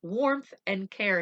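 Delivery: loud, high, urgent doctor; doctor's warning. Acted. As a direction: tell her gravely but firmly. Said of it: "he said".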